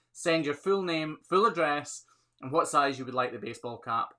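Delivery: ordinary talk, nothing marked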